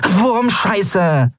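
high-pitched little curse; cursing. A narrator's reading. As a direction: swear in a very high voice. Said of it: "fluchte Nelen". (hohes, empörtes Fluchen)

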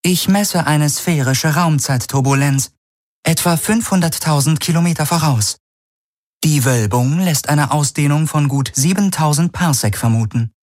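high, neutral onboard computer reading out measurements; ship computer. Acted. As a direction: report data like a calm machine. Ship's computer reports.

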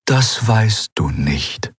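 very slow, measured apparition; otherworldly eidolon, calm. Acted. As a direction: answer calmly, stretching each word.

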